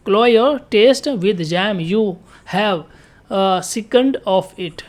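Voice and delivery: very slow, word by word, as if dictating; slow dictation. Shown as a demonstration. Teacher reading an example sentence to students, slow and deliberate. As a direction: spell it out slowly so the class can follow. Teacher repeats phrase slowly.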